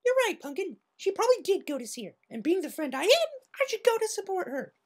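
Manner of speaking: very high, chirpy; cheerful big sister to a foal; Pinkie voice. Acted.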